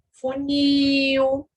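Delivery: "funil" with NIL held long on a level tone; final syllable drawn out. Shown as a demonstration. Teacher giving examples of oxítonas, "funil" with NIL stretched. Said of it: "a sílaba tônica se localiza na última sílaba".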